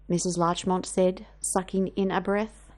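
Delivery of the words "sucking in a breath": usual speaking voice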